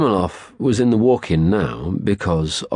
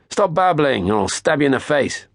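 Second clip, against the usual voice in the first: threat; 9 semitones higher than usual. A narrator's reading. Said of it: "that was another one".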